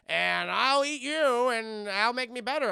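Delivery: slow, loud villain threat; hammy Wesker menace. Retold or imitated. Wesker threatening to eat Alice, slow loud menace. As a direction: relish the threat like a hungry monster. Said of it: "he's like".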